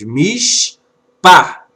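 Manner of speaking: "mis" held, then a separate, loud "pa"; syllable-by-syllable. Shown as a demonstration. Teacher building the word from its syllables, "mis" and "pa" sounded separately. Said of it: "a pronúncia dessa palavra".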